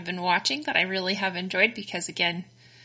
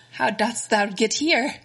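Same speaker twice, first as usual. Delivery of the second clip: mock old English; 4 semitones higher, slower than usual. Joking olde-English voice.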